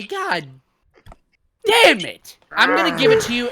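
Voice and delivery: high, loud cursing at a friend; exasperated outburst. Real, not acted. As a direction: burst out, then give in grudgingly. Host annoyed at losing his flow.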